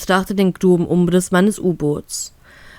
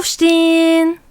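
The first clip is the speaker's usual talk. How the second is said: long, stretched shout, much higher and slow; drawn-out loud wake-up call; 9 semitones higher, slower than usual. Acted. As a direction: drag the word out to wake him. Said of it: "dringt es langgezogen und unglaublich laut". (langgezogen und laut gerufen)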